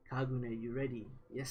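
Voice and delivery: usual speaking voice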